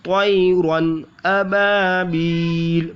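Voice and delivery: अबाबील with the बा held one alif; slow tajweed reading; slow recited demo. Shown as a demonstration. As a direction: stretch the बा in अबाबील. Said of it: "इसको खींचना है एक अलिफ तक".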